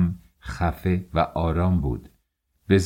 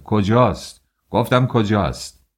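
higher, louder angry shout; furious demand; 4 semitones higher, 5 dB louder than usual. A narrator's reading. Furious father demanding where his daughter is, shouting. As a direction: hurl the question, losing patience.

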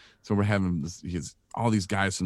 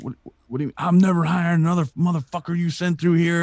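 higher, louder mock boss rant; retold angry rant; 10 semitones higher, 5 dB louder than usual. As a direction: rant like a boss who was just burned. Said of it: "he's instantly mad".

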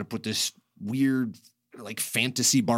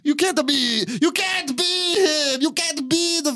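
put-on crazy Italian accent, very high and worked up; hammed-up Italian producer protesting shrilly; Dino De Laurentiis; 4 dB louder, slower than usual. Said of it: "had this crazy Italian accent".

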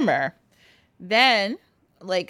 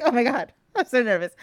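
higher, quick, giggly jitters; mock nervous; 4 semitones higher, faster than usual. Girl facing a talking doll, playfully nervous. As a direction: play nervous through a laugh. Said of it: "Don't be nervous".

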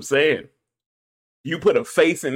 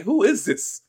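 surprised; 10 semitones higher, slower than usual. Pretend.

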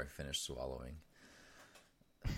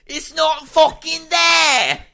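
put-on Australian accent, much higher, much louder and slow; hammed-up Aussie shout, stretched out; 21 dB louder, much slower than usual. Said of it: "did you turn Australian there for a second".

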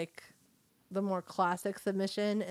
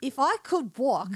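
amateur British accent, higher and louder; 5 semitones higher, 5 dB louder than usual. Shown as a demonstration. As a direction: attempt a hammy British accent. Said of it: "with a British accent".

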